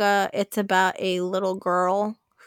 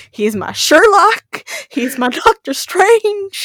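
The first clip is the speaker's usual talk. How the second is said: high, loud, slow dreamy swoon; swooning fangirl; 9 semitones higher, 7 dB louder, slower than usual. Retold or imitated. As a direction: gush over your crush, stretching every word. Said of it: "And I'm just like".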